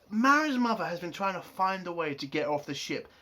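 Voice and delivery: ordinary talk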